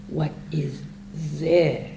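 slow, with "this" set apart and drawn out; stress on this. Shown as a demonstration. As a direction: save the weight for a long final "this". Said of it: "Where should I put the emphasis?".

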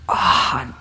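breathy released "ah"; happy body sigh. Shown as a demonstration. Teacher showing polite class a body sound, breathy relieved sigh. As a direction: let go with a sighed-out "ah". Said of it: "the body is really happy when it goes".

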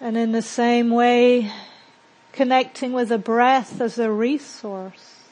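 measured meditation guide with long, settling pauses; guided meditation. Acted. Teacher guiding a group to settle into silence and breathe, guided meditation. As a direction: lead them into calm, leaving space between phrases.

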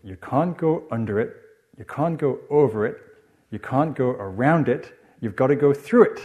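measured, emphatic Geordie rhyme; Geordie accent. Retold or imitated. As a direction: chant it proudly like a small Geordie child. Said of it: "you'd say it in a Geordie accent".

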